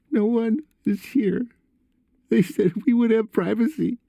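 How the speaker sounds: put-on very high, strained boy's voice; upset son. A narrator's reading. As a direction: voice a distressed son far above your own pitch.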